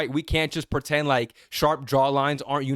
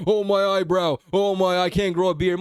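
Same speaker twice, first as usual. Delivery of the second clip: mock whiny complaint. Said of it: "complaining about".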